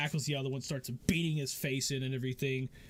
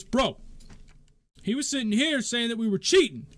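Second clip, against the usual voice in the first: loud, high, indignant protest; indignant complaint; 10 semitones higher, 8 dB louder than usual. Acted. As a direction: complain loudly, feeling accused. Fighter complains about accusation.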